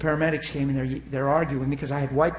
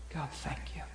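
whispered prayer of thanks; soft unvoiced thank you; 13 dB quieter than usual. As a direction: breathe it out to God.

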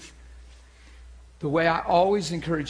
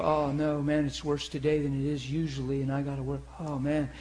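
low, soft, weary complaint; mildly dejected morning grumble; dismayed groan; 5 semitones lower, 5 dB quieter than usual. Acted. Preacher voicing someone waking up in pain, dismayed groan. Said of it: "the tendency is to go".

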